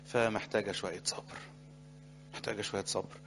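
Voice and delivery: quietly urging patience; soft counsel. Real, not acted. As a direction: let it sink in gently.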